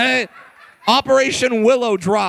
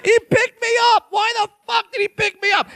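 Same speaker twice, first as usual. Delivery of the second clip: high, squeaky-pitched protest; worked-up, high incredulous rant; indignant disbelief; 9 semitones higher than usual. Acted. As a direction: protest in comic outrage. Acting outrage at being picked up.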